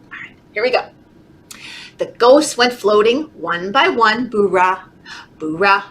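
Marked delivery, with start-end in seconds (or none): none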